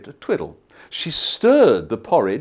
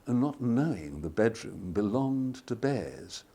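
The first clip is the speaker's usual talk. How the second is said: ordinary delivery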